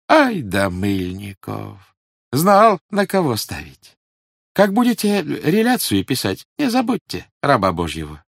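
high, oily police informer; fawning, sly old agent; sly obsequious agent. A narrator's reading. Voices Mylnikov.

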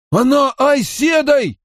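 high, strained accusation; angry outburst. A narrator's reading. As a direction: burst out in indignation. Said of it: "гневно начал Харри". (гневный выкрик на высоких нотах)

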